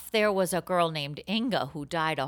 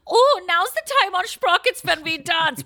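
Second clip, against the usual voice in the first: put-on high, loud German accent; 10 semitones higher, 9 dB louder than usual. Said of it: "all of my accents come back to German".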